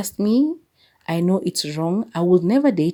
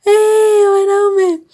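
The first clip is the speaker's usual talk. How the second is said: high, loud wailing hey, men; exasperated cry; 10 dB louder, much slower than usual. Real, not acted. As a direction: wail it out in exasperation. Crying out in disbelief.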